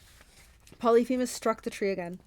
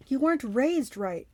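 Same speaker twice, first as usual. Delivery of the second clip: slow, high Cyclops wail; drawn-out, higher-pitched lament; 5 semitones higher, 3 dB louder, slower than usual. A narrator's reading. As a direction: wail it out slowly. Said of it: "Polyphemus wailed".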